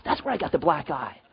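normal talking voice

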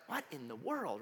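quiet, bewildered aside; hushed bewilderment. Retold or imitated. Kid watching tongues in church, hushed bewilderment. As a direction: say it low, as if baffled.

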